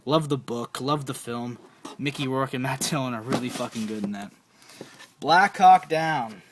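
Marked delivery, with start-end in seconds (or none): 5.2-6.4 slow announcement. Shown as a demonstration. Naming the movie to viewers, slow loud title. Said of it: "when you say it really fast".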